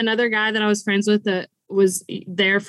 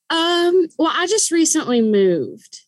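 drawn-out high um, then an excuse; stall, then bluff your way out; hesitant bluff; 7 semitones higher, slower than usual. Retold or imitated. Bluffing about her fake address.

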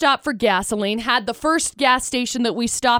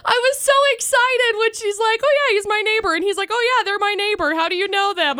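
higher, eager retelling; excited; 9 semitones higher, faster than usual. Real, not acted. Telling the host about the neighbor, excited and higher. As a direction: bubble over with the news.

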